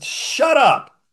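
sharp high loud snap, half-acted; angry retort. Counselling got heated, preacher snaps back at the guy, loud and high. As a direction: fire it back like you've lost patience. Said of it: "I got kinda pissed off".

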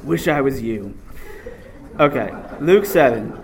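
ordinary talk, nothing marked

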